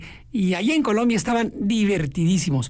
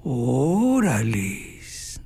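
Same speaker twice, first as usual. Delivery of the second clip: "Órales" stretched out very slowly and much lower, an appreciative drawl; admiring órales; 9 semitones lower, much slower than usual. Retold or imitated. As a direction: draw out "Órales" low and slow, like at a pretty girl passing. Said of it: "va pasando una muchacha bien guapa y dicen". ("Órales" alargado, lento y grave, con admiración)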